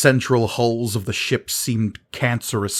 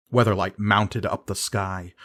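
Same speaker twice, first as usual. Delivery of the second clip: ordinary delivery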